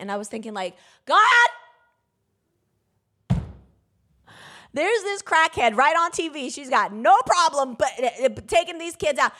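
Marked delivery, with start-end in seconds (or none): 1.1-9.3 high, loud indignant cry to God; reliving a furious complaint, much higher and louder; retold outcry. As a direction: shout it up at God as you relive the anger. Retold angry outburst at God.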